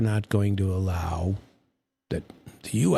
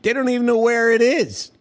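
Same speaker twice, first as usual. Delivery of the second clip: exasperated outburst; 13 semitones higher, 5 dB louder than usual. Real, not acted. Exasperated rant about the uranium plan.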